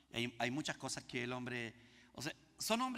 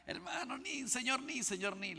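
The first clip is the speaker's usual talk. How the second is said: high, urgent call after someone; a running boy calls out to stop them; 10 semitones higher, slower than usual. Retold or imitated.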